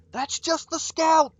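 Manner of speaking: high, slow yell of warning. Acted. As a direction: shout it across the field. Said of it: "Sapricot yells in your direction".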